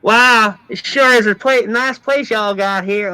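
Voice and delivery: high, loud, drawling gunslinger twang; hammed-up Old West gunslinger accent; mock cowpuncher accent, big and high. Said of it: "Like, talking like this".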